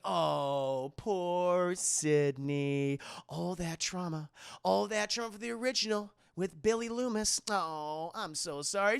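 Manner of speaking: slow, drawn-out sarcastic pity; mock sympathy. Killer taunting Sydney, slow mock pity. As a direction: pretend to pity her, mocking. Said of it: "Timothy Olyphant's like".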